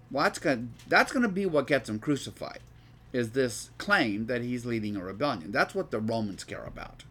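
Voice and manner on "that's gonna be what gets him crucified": usual speaking voice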